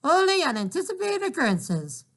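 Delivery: high squawky bird voice; parakeet voice. Acted. Father imitating the parakeet Dolores, high squawk. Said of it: "in the voice of Dolores".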